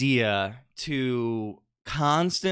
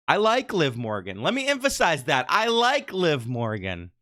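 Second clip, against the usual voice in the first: ordinary talk, nothing marked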